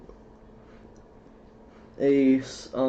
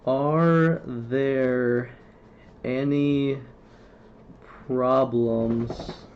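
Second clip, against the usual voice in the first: very slow question, long gaps between words; stuttered speech; much slower than usual. Real, not acted. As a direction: stretch and block word by word. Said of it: "can't even say the word".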